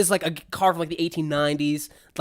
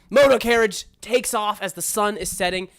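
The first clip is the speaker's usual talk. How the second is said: usual speaking voice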